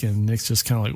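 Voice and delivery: plain reading voice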